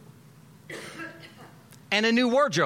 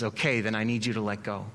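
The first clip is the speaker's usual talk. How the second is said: calm God voice; 8 semitones lower, 4 dB quieter, faster than usual. Acted. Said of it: "and God says".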